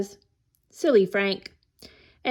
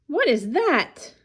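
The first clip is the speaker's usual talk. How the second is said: mild startled cry, pitched up; 4 semitones higher than usual. A narrator's reading. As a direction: exclaim in alarm at a strange noise. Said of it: "cries Frank".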